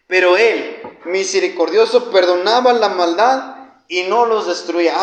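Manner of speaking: loud, forceful preacher quoting scripture; emphatic scripture reading. Real, not acted. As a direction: proclaim the verse at full volume. Preacher reads verse at full force. (lectura bíblica proclamada con fuerza)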